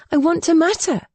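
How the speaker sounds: high, emphatic plea to matter; pleading. Acted. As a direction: insist on it with rising passion. Repeated line, stronger take.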